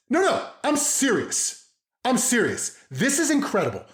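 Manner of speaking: very high, incredulous over-the-top praise; happy losing it. Acted. Dad praising kid who fetched sibling's napkin, elated mock-angry rant. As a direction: rave as if scolding, but delighted. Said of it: "match the energy of getting mad, but I'm happy".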